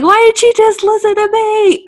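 high, loud frustrated outburst; frustrated yell. Retold or imitated. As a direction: yell it like a fed-up man in a movie. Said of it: "he yells that".

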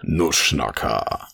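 tief und langsam, immer tiefer; tiefe Intro-Stimme. Acted. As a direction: wie ein dunkler Showansager, gedehnt. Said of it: "einmal jede Folge und lassen einfach genau das so einspielen". (tiefe, gedehnte Ansagerstimme)

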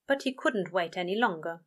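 ordinary delivery, nothing marked

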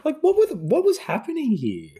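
very high, squeaky-pitched disbelief; incredulous. Real, not acted. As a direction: pitch leaps up in utter bafflement. Disbelief at the terrible CGI scene.

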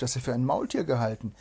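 ordinary delivery, nothing marked

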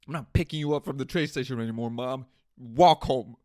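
loud, fast mock scolding of mom; hammed-up loud rant at his mother; pretend telling off. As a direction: yell it at mom like a sulky kid.

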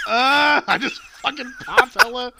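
high, loud laughing yelp; laughing shock. Retold or imitated. Telling his friend about the joke, laughing shocked yelp. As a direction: burst out laughing in surprise.

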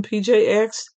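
ordinary delivery

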